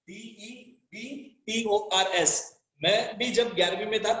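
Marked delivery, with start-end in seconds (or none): 0.1-2.6 डीईबीटीओआरएस spelled letter by letter, slowly; spelling out letters. Shown as a demonstration. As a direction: spell डीईबीटीओआरएस one letter at a time, slow. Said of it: "डेटर का spelling ये है". (अक्षर-अक्षर धीरे से spelling)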